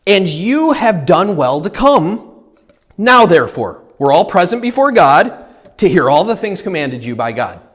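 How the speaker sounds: solemn reading from the pulpit; scripture reading. Acted. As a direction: read the verse with weight. Reads scripture aloud.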